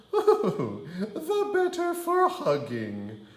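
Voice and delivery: very high laughing granny voice; wolf as granny. Acted. Wolf as Grandma shrugging off the biceps. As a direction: giggle it off like a fake granny. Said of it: "her grandma said, shrugging".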